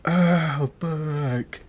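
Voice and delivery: high, drawn-out cry of pain; pained yelp. Retold or imitated. Player hit in the neck by a ball, pained groan. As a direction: re-enact getting smacked from behind.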